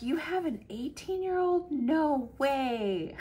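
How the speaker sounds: high, bright imitation of amazed strangers; mock disbelief. Strangers gushing over her young kids, mock amazed. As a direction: ham up their shock, pitch way up.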